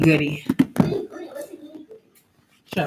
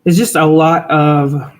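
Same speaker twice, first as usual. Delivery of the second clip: loud slow explaining; 3 semitones lower, 5 dB louder, slower than usual. Real, not acted. Loud slow over child.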